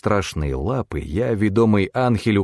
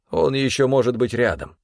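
higher, controlled warning; cool, concentrated character voice, raised in pitch; cold, focused; 5 semitones higher than usual. A narrator's reading. Stefan warns that the killer may be near, cold and focused. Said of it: "Теперь его голос был холоден и сосредоточен".

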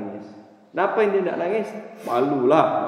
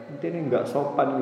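ordinary talk, nothing marked

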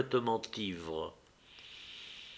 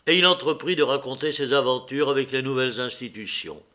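plain reading voice

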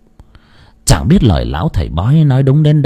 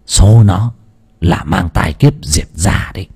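a lightly voiced old sorcerer, hushed and low; a master lowers his voice to deliver doom; low grave fortune teller; 5 semitones lower than usual. A narrator's reading. Sorcerer tells the father his daughter is cursed, hushed and grave. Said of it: "quay sang thầy trầm giọng".

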